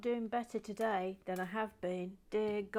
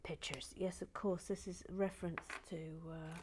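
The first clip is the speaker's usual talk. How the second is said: soft unvoiced whisper while building; 5 dB quieter than usual. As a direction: breathe the words, barely voiced.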